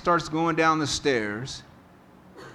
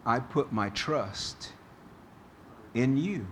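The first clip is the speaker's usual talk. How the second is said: quiet trust; 5 dB quieter than usual. Retold or imitated. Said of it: "response when we are afraid should be".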